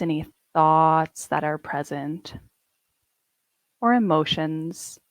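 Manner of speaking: calm, unhurried meditation guide with long pauses; guided meditation. Acted. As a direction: ease the listeners into their bodies, gently.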